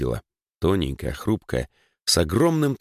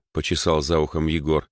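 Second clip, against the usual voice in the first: ordinary delivery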